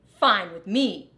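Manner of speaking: defiant rat retort; curt retort. Acted. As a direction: spit it back at him.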